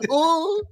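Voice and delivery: high-pitched mock "oh" cry; Gary Neville. Fan mimics commentator at a near miss, mock-astonished. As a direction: imitate a commentator's startled high oh. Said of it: "and Neville goes".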